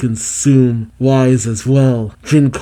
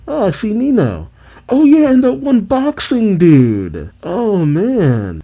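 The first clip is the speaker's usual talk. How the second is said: excited recognition; 9 semitones higher than usual. Real, not acted. Fan spots favourite minor characters.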